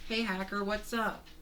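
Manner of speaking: high character voice hello; cartoon greeting. Character walking up to Hacker, high cartoon greeting. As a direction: call it out in a high voice.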